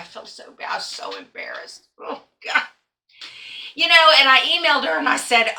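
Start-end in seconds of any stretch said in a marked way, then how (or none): 0.0-2.6 whispered, much softer, mortified; embarrassed whisper. Real, not acted. Quilter admitting her block error to viewers, whispered. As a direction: breathe it out in shame.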